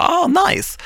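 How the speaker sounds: breezy, very high "Ah, nice"; unaware, overly casual brush-off; fake-casual nice. Casual reply to former bully.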